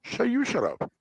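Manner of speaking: high squeaky mock yell; heated family shouting. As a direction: squeal it back teasingly. Playful "shut up" squabble.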